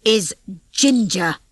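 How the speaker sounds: very slow, cold threat; slow and deadly. Acted. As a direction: spell out each word like a warning. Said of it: "in a slow and deadly voice".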